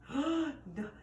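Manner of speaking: nervous. A narrator's reading. Puppy terrified of the pound, stammered high fear. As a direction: catch your breath and stammer it scared. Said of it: "I asked nervously".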